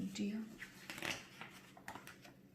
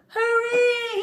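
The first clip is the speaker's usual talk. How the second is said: high, loud cheer; shouted hooray; 9 dB louder than usual. Acted. Pig leaping off the tongue, loud high cheer. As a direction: shout it out with joy. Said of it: "he shouted".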